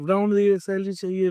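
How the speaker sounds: light mock of a demanding kid; mocking whiny kids. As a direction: mildly parrot impatient kids asking for pay. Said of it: "बच्चे पागल की तरह कह रहे हैं".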